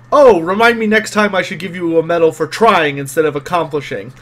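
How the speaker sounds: loud, scornful mock praise; eye-rolling sarcasm. Game master as Gustav mocking a crewmate's failed try, sarcastic. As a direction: lay on the sarcasm with an eye-roll. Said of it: "he rolls his eyes and he says".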